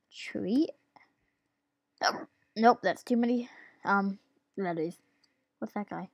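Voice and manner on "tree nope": breathy whispered guess. Real, not acted. Guessing quietly at answer.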